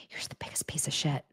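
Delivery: unvoiced, hissed aside; whispered insult. Real, not acted. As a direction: whisper the insult under breath.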